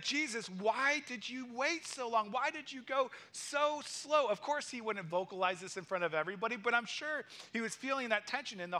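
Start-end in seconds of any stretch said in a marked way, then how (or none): 0.0-4.3 slow, higher, pleading complaint; frustrated plea to Jesus. Retold or imitated. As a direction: let the frustration drag out the words. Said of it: "I'd be like".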